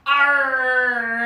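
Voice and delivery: a loud pirate "Arr"; pirate growl. Acted. Yoga teacher shows kids a pirate cry, loud. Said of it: "what does the pirate say".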